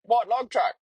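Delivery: single out the truck, needling; angry trucker on the radio. Retold or imitated. Angry driver naming the truck over radio, pointed. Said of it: "And he said".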